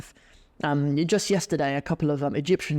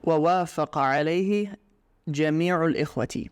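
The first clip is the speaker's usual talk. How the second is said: story reading; faster than usual. Acted. Reading the Arabic story text.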